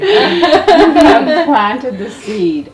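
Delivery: loud, slow words through real laughter; bursting with laughter; laughing. Real, not acted. Host laughing with delight.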